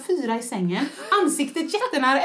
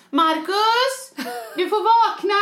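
higher, louder call to wake Marcus; wife calling her husband in a panic, raised voice; panicked call; 5 semitones higher, 3 dB louder, much slower than usual. Retold or imitated.

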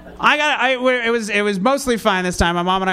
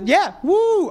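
sarcastic woo; 9 semitones higher than usual. Pretend. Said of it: "was that a sincere or sarcastic woo".

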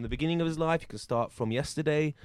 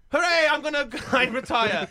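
excited; 10 semitones higher, 5 dB louder than usual. Pretend. Judge celebrates retiring.

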